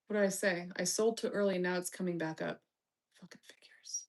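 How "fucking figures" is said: unvoiced muttered curse; bitter whisper. Real, not acted. Streamer cursing her stock loss in a whisper. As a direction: whisper it under your breath. Stock sold too early.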